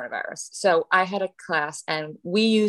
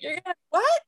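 high, incredulous what; startled question; 11 semitones higher than usual. Retold or imitated. Teacher hearing a kid wants to be unspeakable, incredulous. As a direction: sound thrown by a strange answer.

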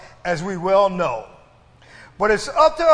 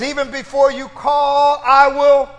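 loud, ringing proclamation; shouted quote; 4 semitones higher, 7 dB louder than usual. Acted. Preacher shouting God's promise to the church.

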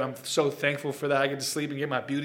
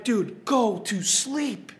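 weary plea; 6 semitones higher, much slower than usual. Retold or imitated. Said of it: "And I'm like".

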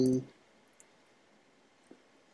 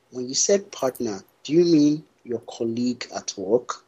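usual speaking voice

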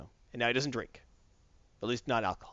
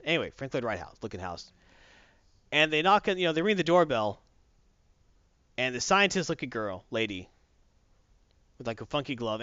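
ordinary talk

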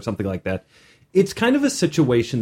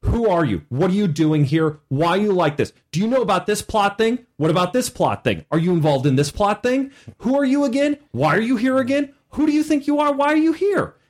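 rapid questions rising ever higher; badgering players; 8 semitones higher than usual. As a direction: pester the NPC, climbing in pitch. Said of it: "they say stuff like".

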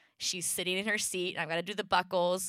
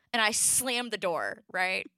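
usual speaking voice

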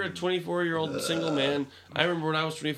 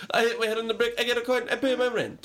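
high cartoon Mario voice; chirpy video game plumber; 8 semitones higher, faster than usual. Mario game sound impression.

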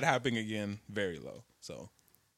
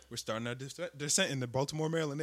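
calm pilot announcement; 2 semitones lower, 10 dB quieter, faster than usual. Retold or imitated. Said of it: "He said".

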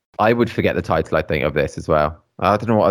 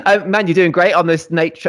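laughing; 7 semitones higher than usual. Real, not acted. Still laughing after rivalry joke.

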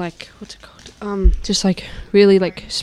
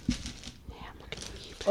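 whispering; 17 dB quieter than usual. Real, not acted. Teasing whisper at co-host.

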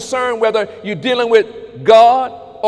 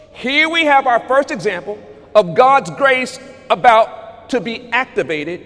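ordinary talk, nothing marked

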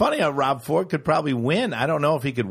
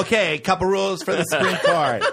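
mock announcer; 6 semitones higher, 4 dB louder than usual. Mocking a ride operator speech.

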